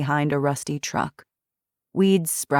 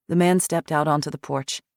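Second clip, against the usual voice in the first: plain reading voice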